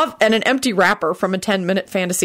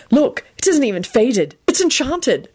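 proud; 7 semitones higher than usual. Acted. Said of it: "George announced proudly".

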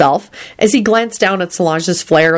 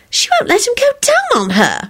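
very high, gossipy burst; excited outburst. A narrator's reading. Solange oversharing with Quentin, high and excited. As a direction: blurt it in a squeal. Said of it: "she burst out excitedly".

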